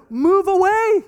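exasperated yell. Retold or imitated. Father shouting at daughter to get away, loud. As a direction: shout at her to move.

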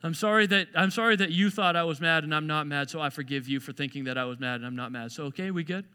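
low, sinking apology that trails off softly; grudging apology. Acted. Husband making a blame-shifting apology, grudging.

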